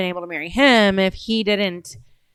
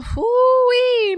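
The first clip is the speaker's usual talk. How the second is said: high, loud whooping exclamation; stunned hoo-wee; 6 dB louder than usual. Real, not acted. Streamer reacting to a wild video, loud amazed whoop. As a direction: let out a big amazed whoop.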